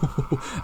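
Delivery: short high nervous laugh; a quick jittery laugh after a scare. Real, not acted. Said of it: "il m'a fait peur".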